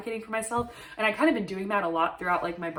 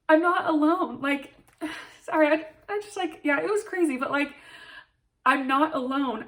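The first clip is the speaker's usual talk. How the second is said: high, choked-up voice near crying; barely holding back tears; reliving the moment, voice breaking with emotion; tearful; 6 semitones higher than usual. Real, not acted. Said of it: "it makes me wanna cry".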